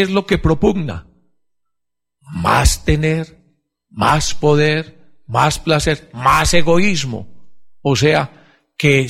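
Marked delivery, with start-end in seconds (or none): 2.2-7.5 each item spaced out slowly; slow emphatic list. Acted. Preacher slowly listing what the world preaches.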